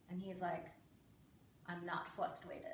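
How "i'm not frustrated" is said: low, soft deadpan; emotionless little boy. Retold or imitated. Son denying frustration to parent, low deadpan. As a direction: mimic a stony kid with no emotion. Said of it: "He was like the Vin Diesel of children".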